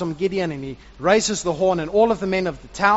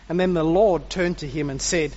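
usual speaking voice

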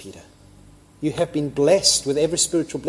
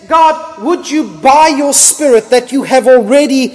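louder, slow, pleading prayer to God; voicing Paul praying for the church, louder and earnest; Paul's earnest prayer; 4 semitones higher, 8 dB louder, slower than usual. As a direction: pray it out loud on the apostle's behalf. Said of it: "Paul says".

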